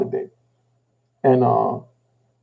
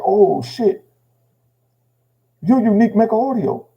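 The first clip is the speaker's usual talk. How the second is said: higher-pitched retold surprise; astonished recognition; 7 semitones higher than usual. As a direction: imitate an officer suddenly recognizing someone famous. Officer recognizes him, retold.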